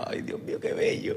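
mock-adoring soft murmur; hushed gushing. Podcast guest swoons over a rival player he loves, hushed gushing. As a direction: breathe it low, as if swooning.

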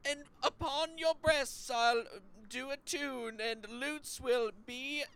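over-the-top Shakespeare verse; hammed-up Shakespeare. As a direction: declaim like a bad stage bard. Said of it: "the bard's most famous soliloquy".

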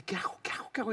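whispered, frantic "what do I do"; panicked whisper. Retold or imitated. Man panicking privately before his date, whispered. Panicking alone outside the bathroom.

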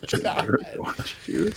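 unvoiced whisper, repeating; whispered echo. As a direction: breathe it back as a whisper. Echoed in a mocking whisper.

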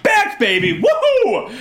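whooping, high-pitched celebration; real jubilant shout; excited yelling. Real, not acted. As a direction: holler it out sky-high like a thrilled fan. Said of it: "lot less yelling for that one".

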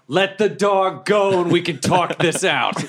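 high, drawn-out plea; acted yell. Player pleads with wizard for his dog, high drawn-out yell. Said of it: "And then I just yell".

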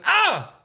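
high-pitched scream of pain, loud. Retold or imitated. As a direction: yelp it out sharp and high. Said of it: "Like that".

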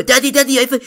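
put-on high, eager little child calling for Daddy; excited kid voice. Father imitating his kids calling out during an Easter egg hunt, high excited child voice. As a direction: squeal it like a kid who found an egg.

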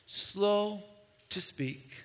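slow to speak, with long pauses between; drawn-out slowness. Shown as a demonstration. Preacher playfully demonstrating being slow to speak, drawn-out slowness.

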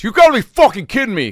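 high, loud exasperated outburst; angry customer. Retold or imitated. Angry customer in line at the Dairy Queen, high and loud. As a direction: blurt it like a fed-up customer. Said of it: "The guy who goes".

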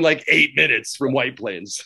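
ordinary talk

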